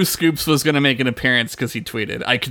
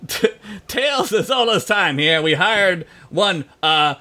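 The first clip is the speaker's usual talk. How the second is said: higher, thick mock New York accent; 5 semitones higher than usual. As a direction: ham up a Brooklyn salesman.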